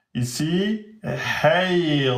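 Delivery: ordinary delivery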